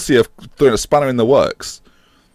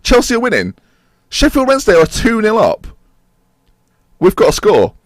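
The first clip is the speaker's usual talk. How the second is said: normal talking voice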